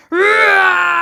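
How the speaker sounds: long, high, very loud animal roar; bear roar. A narrator's reading. Bear turns on the mosasaurus, roaring. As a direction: roar back fiercely like a bear. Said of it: "a bear roaring back at it".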